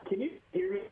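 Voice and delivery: distant, muffled phone line; talking from across the room; far from mic. Real, not acted. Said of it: "it sounds like you took your phone, threw it across the house".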